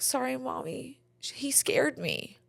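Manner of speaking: put-on small, soft little-kid voice; scared child. Scared little girl apologizing to her mother, small soft voice. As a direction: shrink it down to a timid child's apology. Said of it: "scared of him".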